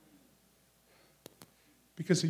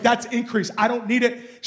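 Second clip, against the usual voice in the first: loud, higher quote of her resolve; raised, insistent pulpit voice; forceful preaching; 5 semitones higher, 7 dB louder than usual. Retold or imitated. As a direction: preach her words with conviction. Preaches the woman's words.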